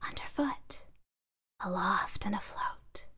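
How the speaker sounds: soft whispering voices; whispering bags. A narrator's reading. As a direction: whisper like rustling plastic. Bags whisper their travels.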